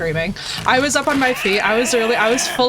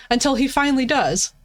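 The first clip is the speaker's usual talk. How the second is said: ordinary talk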